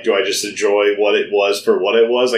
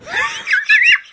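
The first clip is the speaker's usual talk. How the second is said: a sudden squealing burst of laughter, much higher; high shrieking laugh. Real, not acted. Cohost laughing at his own joke, high shrieking laugh.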